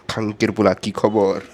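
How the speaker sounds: Bengali greeting said the local Sylheti way; Bengali dialect phrase. Shown as a demonstration. Bengali greeting taught to a foreigner, local Bengali accent. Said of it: "you have to say".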